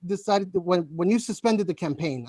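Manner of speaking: tripping over the words with false starts; halting, repeated starts, searching for the word; stuttering. Real, not acted. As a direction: stammer and restart the phrase until it comes out. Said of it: "I'm sorry, I'm stuttering a little bit".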